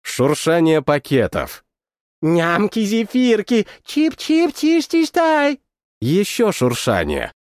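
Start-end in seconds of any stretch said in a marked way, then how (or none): 2.2-5.6 high, slow cute munching babble; creature gibberish. Acted. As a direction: babble like a hungry little creature. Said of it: "на забавном языке оголодавших вейк".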